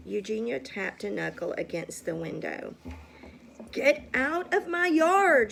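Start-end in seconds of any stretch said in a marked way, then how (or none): 3.7-5.5 loud, high angry yell at the pig; angry shout. A narrator's reading. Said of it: "she shouted to Mercy".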